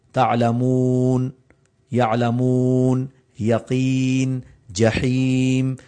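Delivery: تعلمون, یعلمون, یقین and جحیم with long drawn vowels. Shown as a demonstration. Teacher showing how long the madd vowels are held. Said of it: "ان کو لمبا کیا جا سکتا ہے".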